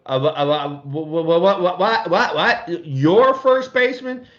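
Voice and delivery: stuttering wha-wha-what, rising high on Your first baseman; can't believe what he's hearing; retold disbelief, voice jumping up; stunned stammer. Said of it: "And I go".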